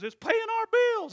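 high, loud put-on wife voice; wife replying matter-of-factly; wife's voice. Wife explains to husband, high and loud.

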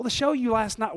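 normal talking voice

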